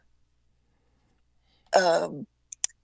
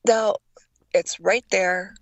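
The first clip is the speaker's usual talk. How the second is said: normal talking voice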